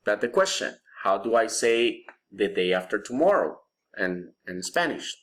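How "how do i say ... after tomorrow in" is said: ordinary delivery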